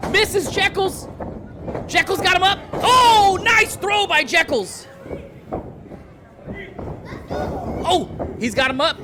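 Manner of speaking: excited live play-by-play call; wrestling commentator. Acted. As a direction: call the match as it happens.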